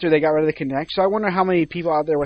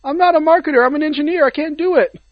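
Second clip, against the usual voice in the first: high, loud, whining plea; helpless underling at breaking point; desperate protest; 10 semitones higher, 4 dB louder than usual. Acted. Engineer tells the boss he can't market it, desperately.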